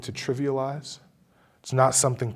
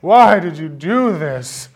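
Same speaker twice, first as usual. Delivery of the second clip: slow, high, pitiful whine through a laugh; 5 semitones higher, 11 dB louder, slower than usual. Retold or imitated. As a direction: whimper it like a self-pitying child. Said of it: "Sounds so pitiful".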